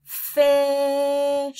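loud drawn-out "Fish"; "Fish" modelled for the class; blended word. Shown as a demonstration. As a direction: stretch "Fish" out slowly. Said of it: "Do it one more time".

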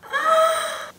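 very high squealing gasp with a laugh; delighted squeal-gasp. Real, not acted. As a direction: gasp and giggle at once. Laughing over small details.

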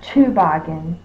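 toboggan opened with a full "too"; mispronounced first vowel. Shown as a demonstration. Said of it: "not to".

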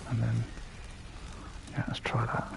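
hushed whisper to himself. Real, not acted. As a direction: whisper the plan under your breath. Quiet plan while gaming.

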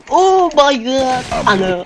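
surprised shriek. Real, not acted. Finds friend knocked out.

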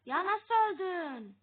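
high, loud and slow; real frustrated shout; disbelieving yell. Real, not acted. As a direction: cry out at a sudden death. Gamer dies in disbelief.

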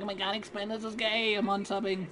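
high, mocking whiny fan voice; high mocking fan whine. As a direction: sneer it like an offended kid fan. Mocking a fan's whiny reaction.

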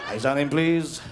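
bingo caller. Acted.

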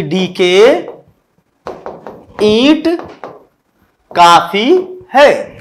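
very slow, word by word, with long pauses; acted calm. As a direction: say it at ease, spacing out each word. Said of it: "आराम से कहा कि". (आराम से, रुक-रुक कर, धीमे)

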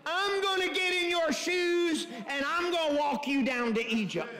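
high, ringing preacher's declaration; full-force preaching. Real, not acted. Preacher voices God's promise to walk Moses to Egypt, in full-force preaching.

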